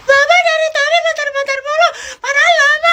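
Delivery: put-on high whiny child's complaint. Child whining to the bus driver about waiting. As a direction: whine like an impatient little kid.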